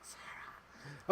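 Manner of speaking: hushed, drawn-out whisper; playful whisper. Pretend. Host calling to co-host, playful whisper. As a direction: whisper the name teasingly.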